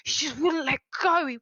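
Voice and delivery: distressed, insistent repeating; upset and angry. Acted. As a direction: blurt it out, getting worked up. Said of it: "starts getting upset and angry".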